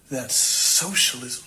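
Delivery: slow, drawn-out disgust. Retold or imitated. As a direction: stretch it out with mock revulsion. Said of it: "looks extremely disgusted, and she replies with".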